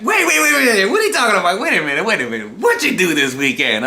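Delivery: high, rapid mock bafflement, easing down at the end; imitated baffled questioner. Friend baffled by an evasive answer about the weekend, mock disbelief. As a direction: cut in as if baffled, laughter creeping in.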